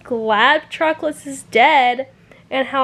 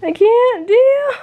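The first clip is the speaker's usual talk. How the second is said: higher, louder, crying out through tears; 8 semitones higher, 6 dB louder, slower than usual. Real, not acted. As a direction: sob it out, completely overwhelmed. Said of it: "I'm crying".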